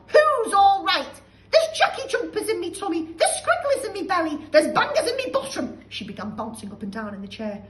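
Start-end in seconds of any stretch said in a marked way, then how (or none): 0.1-5.8 panicked shout. Acted. Grandma burning inside, screeching shout. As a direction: shriek for help.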